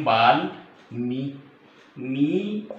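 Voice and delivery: वाल, मी split apart with pauses; syllable by syllable. Shown as a demonstration. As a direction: dictate वाल, then a held मी. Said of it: "Valmiki लिखेंगे".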